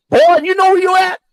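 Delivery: retold threat from a man in the woods, mock menacing and high; mock menacing Klansman. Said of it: "They, they said".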